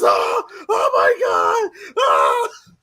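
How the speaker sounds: drawn-out hammed-up agonized scream; loud put-on wail of a burning cartoon man; mock screaming. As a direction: scream slowly in comic agony. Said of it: "he goes around screaming like".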